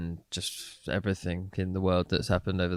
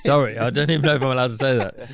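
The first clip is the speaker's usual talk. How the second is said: laughing; 4 semitones higher, 7 dB louder, faster than usual. Real, not acted. Swore on radio, laughing apology.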